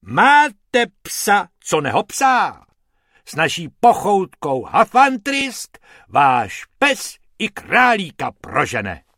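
hammed-up TV advert; pompous ad announcer; puffed-up ad read. A narrator's reading. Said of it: "nafoukl a spustil".

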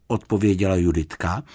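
ordinary delivery, nothing marked

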